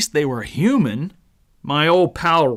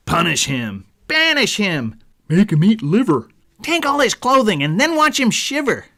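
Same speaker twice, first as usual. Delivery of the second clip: rising, louder glee at each demand; gleeful ganging-up; 6 semitones higher, 3 dB louder than usual. A narrator's reading. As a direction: pile on the punishments with relish. Friends call for punishment.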